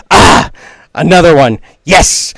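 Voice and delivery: loud, eager little fighter; excited battle cry. Acted. Tiny dandelion swordsman spotting another foe, loud excited outburst.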